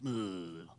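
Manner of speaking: low, soft grumble of disgust; mock-sulky groan under the breath; grumpy little "ugh"; sulky grunt. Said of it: "he's just, like, sitting there".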